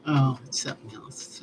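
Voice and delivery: whispered in wonder; awed whisper. Real, not acted. Storyteller telling viewers about horses, in an awed whisper. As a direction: breathe it out, lost in love for horses.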